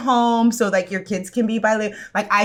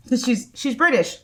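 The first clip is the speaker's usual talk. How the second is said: usual speaking voice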